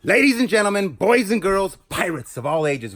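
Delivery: big showman intro; grand entrance announcement. Acted. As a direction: announce it like a ringmaster. Opening a new unboxing episode.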